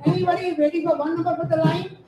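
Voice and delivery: ordinary talk, nothing marked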